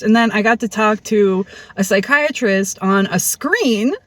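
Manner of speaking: ordinary talk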